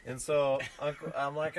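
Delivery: ordinary delivery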